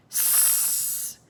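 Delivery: soft, long snake hiss. A narrator's reading. Sea serpent answers with a hiss. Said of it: "hissed the serpent".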